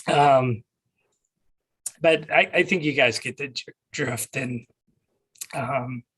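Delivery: hoarse, voice giving out; strained hoarse voice losing strength. Real, not acted. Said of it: "I'm losing my voice".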